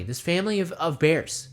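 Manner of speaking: ordinary talk, nothing marked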